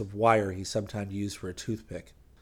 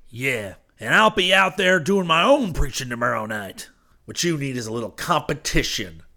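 raised, hint of a ranting rival; impassioned threat; 4 semitones higher, 7 dB louder than usual. A narrator's reading. Preacher vowing his own preaching tomorrow night, impassioned. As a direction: crow it loudly at the competitor. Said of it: "breathless voice say through the tin".

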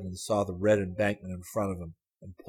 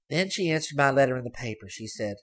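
put-on higher, louder girl's voice teasing; sly girl; 5 semitones higher, 4 dB louder than usual. A narrator's reading. Girl surprising Hayes from the back seat, teasing. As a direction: pipe up high and pleased with herself.

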